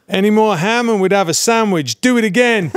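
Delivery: half-hearted Stallone rasp, teasing; imitating Stallone. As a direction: director mocking a hammy take, mildly imitated. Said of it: "he goes".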